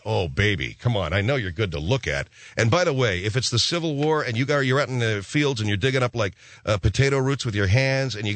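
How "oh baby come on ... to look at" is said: lower, lazy inner voice; lower, unimpressed inner thought; deep inner voice. Retold or imitated. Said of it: "And I'm thinking".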